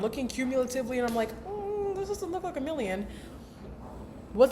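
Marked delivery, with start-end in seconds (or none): 1.5-3.1 a high, soft, doubtful hum into the line; mock her own disappointed look at the account; doubtful mock musing. Imitating her doubtful self.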